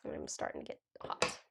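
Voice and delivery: low unvoiced whisper to herself; quiet whispered aside to herself. Real, not acted. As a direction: mutter it under your breath. Aside while crafting on camera.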